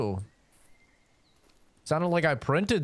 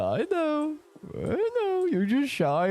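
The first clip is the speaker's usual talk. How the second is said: high teasing voice; slower than usual. Acted. Playfully answering silent wife.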